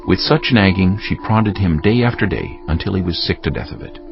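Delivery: ordinary talk, nothing marked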